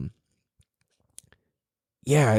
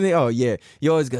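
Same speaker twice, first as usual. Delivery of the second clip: ordinary talk, nothing marked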